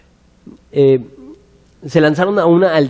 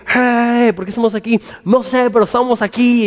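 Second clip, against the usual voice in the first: higher-pitched mock bewilderment; confused crowd; 6 semitones higher than usual. Preacher mimicking the confused crowd at the theater, high and puzzled. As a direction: act out clueless onlookers, voice lifted. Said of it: "Entonces llegaron, están como que".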